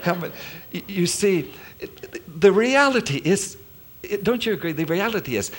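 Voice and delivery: ordinary talk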